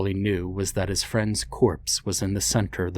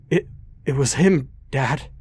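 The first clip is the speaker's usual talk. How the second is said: higher, louder gasping plea; gasped; 6 semitones higher, 3 dB louder than usual. Acted. Injured boy gasping to his father. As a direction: gasp it out, hurt and terrified. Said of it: "Ben gasped".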